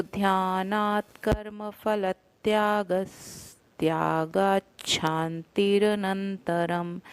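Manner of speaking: slow recited verse line; slow recitation. Shown as a demonstration. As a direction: recite slowly and evenly. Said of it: "उच्चारण स आएगा".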